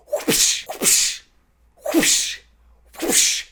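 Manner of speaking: unvoiced whip swishes; whip whoosh. Disney whipping the show, whispered whip swishes.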